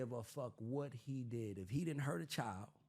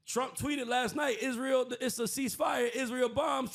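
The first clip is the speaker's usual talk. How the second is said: high, loud, slow mock gossip; public chatter; 12 semitones higher, 7 dB louder than usual. Host mimics the public reacting, high and loud. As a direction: gasp it like the news crowd. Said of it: "while everybody's like".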